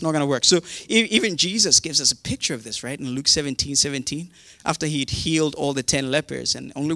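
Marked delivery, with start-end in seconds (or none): none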